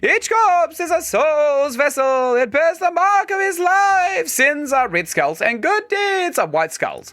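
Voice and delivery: high, slow, drawn-out spectral voice; ghost voice. Acted. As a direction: intone it like a solemn ghost. Keeps ghost voice reading dialogue.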